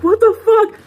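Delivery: loud startled retort to the crying date; shocked outburst. Retold or imitated. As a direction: blurt it up high in astonishment.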